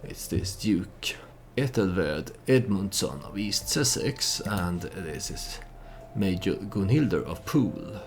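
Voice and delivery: ordinary talk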